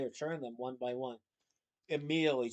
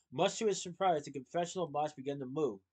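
plain reading voice

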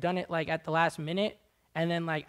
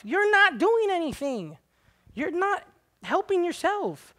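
scolding retort; 12 semitones higher, 6 dB louder, slower than usual. Acted. Preacher answers the complainer.

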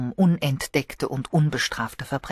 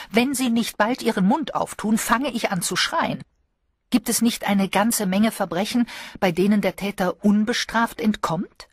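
lightly voiced lady, a touch higher and eager; lady of the house; 6 semitones higher than usual. A narrator's reading. Lady of the house pressing Sir Henry to talk, eager. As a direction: coax him into talking, half teasing.

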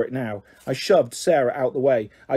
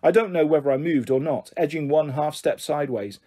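ordinary delivery, nothing marked

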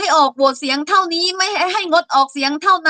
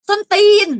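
angry curse; 6 semitones higher, 4 dB louder than usual. Real, not acted. Curse blurted at senators mid-rant.

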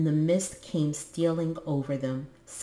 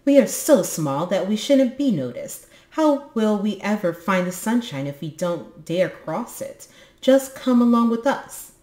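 loud, higher boasting voice; bravado; 5 semitones higher, 7 dB louder than usual. Acted. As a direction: act braver than you feel. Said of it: "wanting to show how brave he was".

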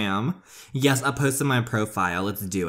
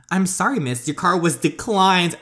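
cashier voice; 6 semitones higher, 4 dB louder than usual. Acted.

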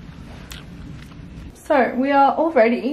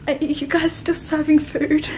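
timid request; 4 semitones higher, slower than usual. Retold or imitated. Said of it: "and I was like".

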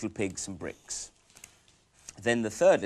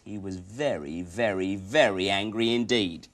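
ordinary delivery, nothing marked

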